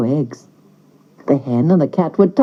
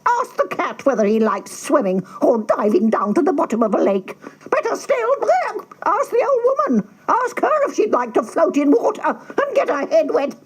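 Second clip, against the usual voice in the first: high, scornful hen voice, rising to a squawk; mock him like a sneering hen; 9 semitones higher, 4 dB quieter than usual. A narrator's reading. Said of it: "said the hen".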